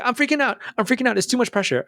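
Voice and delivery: mock-frantic girlfriend; panicked girlfriend. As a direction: play her panicking under the pressure. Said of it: "She's gonna be like".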